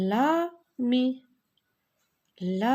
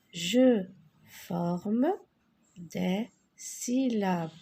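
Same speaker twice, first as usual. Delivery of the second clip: slow syllables; much slower than usual. Shown as a demonstration. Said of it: "teacher repeats slowly".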